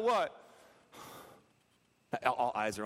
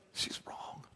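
whispered aside to a friend; 10 dB quieter than usual. Retold or imitated. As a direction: lean in and whisper it. Leaning over to a friend in class.

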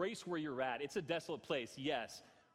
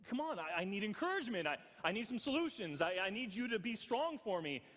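retold frustrated plea; 8 semitones higher than usual. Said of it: "And I'm getting angry".

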